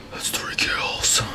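slow hushed whisper. Real, not acted. Gamer spooked by the kill marks, slow whisper.

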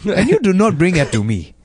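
put-on playful African-accented parrot rant. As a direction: plead for a cracker as the parrot. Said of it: "a voice of him".

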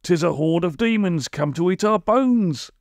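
high, wailing villager's warning; hammed-up dread, swooping up; alarmed cry. A narrator's reading. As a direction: cry out in mock terror. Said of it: "cried one, starting up and pointing with a trembling finger".

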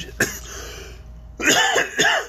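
sudden cough mid-line; real cough. Real, not acted. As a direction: cough and clear throat. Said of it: "excuse me".

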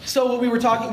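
ordinary talk

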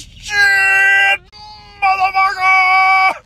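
comic drawn-out cussing; drawn-out exclamation. Acted. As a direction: hold the words long on one high note. Acted exclamation in retold scene.

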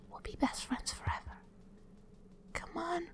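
sweet menacing whisper; creepy whisper. Pretend. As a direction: whisper it like a sinister secret. Whispered promise to prey.

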